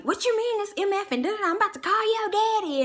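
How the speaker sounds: high, fast mock rant; frantic high-pitched imitation of a furious mom; mom freaking out. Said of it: "she freaking out and going off".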